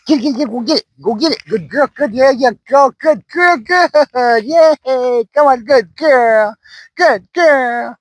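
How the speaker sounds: high, bright cheering for the dog; excited puppy praise. Real, not acted. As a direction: cheer the puppy on as she grabs the toy.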